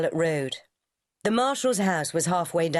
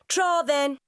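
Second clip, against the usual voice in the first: cheery call; 10 semitones higher than usual. A narrator's reading. Ruby calls goodbye.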